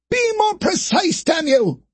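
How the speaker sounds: high, angry rebuke; chastising. Acted. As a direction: scold the operator. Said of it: "Poe chastised the man".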